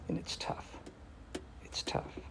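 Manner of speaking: whispered, almost no voice; a hushed whisper of sorrow. Real, not acted. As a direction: let it drop to a breath, heavy. Grief over abandonment and neglect.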